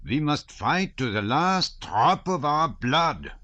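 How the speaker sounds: put-on high, loud, slow old hussar's vow; old colonel vowing loudly to the guests; table-thumping vow. A narrator's reading. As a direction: bang the table and swear it. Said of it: "thumping the table".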